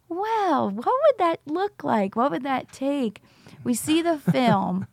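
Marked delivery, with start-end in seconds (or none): 0.1-3.2 slow, high-pitched musing; wondering. Retold or imitated. Friends first picturing a recovery school, wondering. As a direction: retell an idea dawning, drawn out and higher. Retold moment the idea takes hold.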